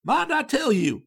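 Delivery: high, loud, rattling Yankee chatterbox; homesick American student. A narrator's reading. Charlie Adams rattles on to the Reverend, gushing and excited. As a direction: let the lonely student gush without pause.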